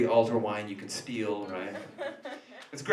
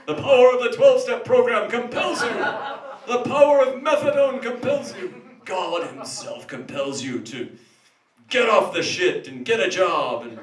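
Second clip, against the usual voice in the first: higher, louder commanding exorcist; exorcist preaching; 9 semitones higher, 7 dB louder than usual. Acted. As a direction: thunder the rite at the demon. Exorcism as rehab sermon.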